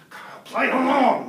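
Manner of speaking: high, drawn-out pleading; urgent aside. Acted. Classmate begs Kyle to join the act, urgent aside. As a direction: urge it slowly, straining upward. Covert urging while pretending to scold.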